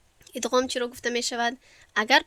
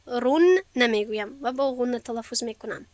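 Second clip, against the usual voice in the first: ordinary talk, nothing marked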